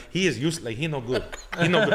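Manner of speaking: put-on bitchy crew guys with a Spanish accent; Spanish-accented impression. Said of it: "I do, like, Spanish guys".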